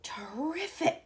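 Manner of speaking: slow, drawn-out delighted cry; cried. A narrator's reading. Grandpa Joe crying out in delight to Wonka, slow. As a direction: relish the word. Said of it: "cried Grandpa Joe".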